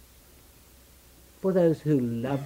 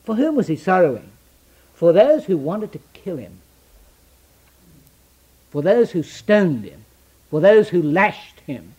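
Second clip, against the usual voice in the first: preaching; 3 semitones higher, 8 dB louder than usual. Acted. Preaching at full force.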